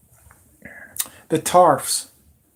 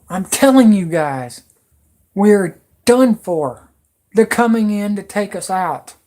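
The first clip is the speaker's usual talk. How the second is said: emotional outburst; 3 semitones higher, 5 dB louder than usual. Real, not acted. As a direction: warn them we're done for, all worked up. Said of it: "I'm so emotional right now".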